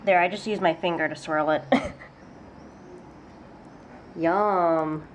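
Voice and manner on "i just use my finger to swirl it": ordinary delivery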